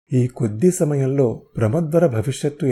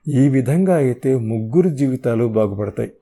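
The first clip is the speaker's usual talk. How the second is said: usual speaking voice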